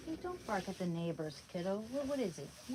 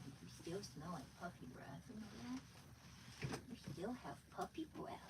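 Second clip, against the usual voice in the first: hushed cooing at the puppy, softer; 6 dB quieter than usual. Real, not acted. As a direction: murmur sweet nothings to a puppy. Cooing at the dog.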